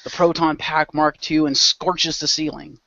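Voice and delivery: ordinary delivery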